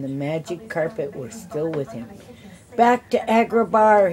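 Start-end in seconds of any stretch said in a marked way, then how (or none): 2.8-4.1 higher, louder urgent shout. A narrator's reading. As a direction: yell the order to the carpet. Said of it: "he shouted to the carpet".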